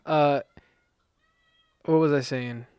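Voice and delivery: ordinary talk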